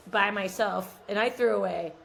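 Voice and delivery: ordinary talk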